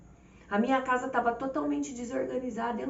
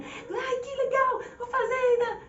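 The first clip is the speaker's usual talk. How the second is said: put-on very high, louder squeal of a happy child; childish delight; 14 semitones higher, 4 dB louder than usual. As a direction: play the giddy child who got her way. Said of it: "sabe, igual criança?".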